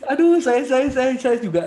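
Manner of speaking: very loud, high, stammering with laughter; flustered laughing. Real, not acted. Father confessing his own worry, flustered laughter. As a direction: struggle to speak through laughter.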